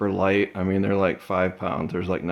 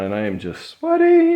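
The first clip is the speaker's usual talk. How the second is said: sweating said much higher, a mock whine; exaggerated complaint; 5 dB louder than usual. Real, not acted. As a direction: squeal out the sweating bit. Complaining about sweating in garage.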